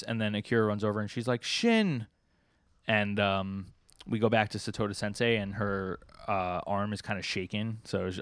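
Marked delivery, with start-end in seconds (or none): 1.4-2.1 high, falling cry of a name; lightly acted call to a friend; calling out in concern. Akira runs over calling his name.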